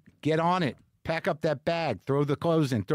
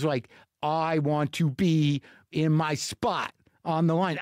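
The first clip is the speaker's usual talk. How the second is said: usual speaking voice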